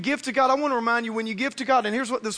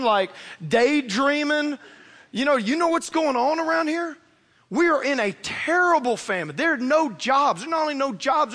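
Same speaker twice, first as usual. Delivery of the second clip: sassy widow; 3 semitones higher than usual. Retold or imitated. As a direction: give the prophet some attitude, hands on hips. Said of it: "she gets this attitude".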